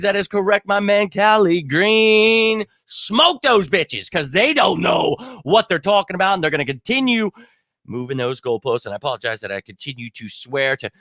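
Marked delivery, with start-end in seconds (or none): 0.0-7.3 hyped agreement. Real, not acted.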